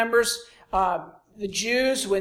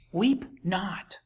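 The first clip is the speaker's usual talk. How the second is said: hushed whispered command; 3 semitones lower, 6 dB quieter than usual. Acted. Jesus hushing the weeping mourners, whispered. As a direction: breathe it out like a secret.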